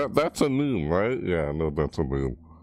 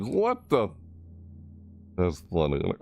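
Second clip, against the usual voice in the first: ordinary talk, nothing marked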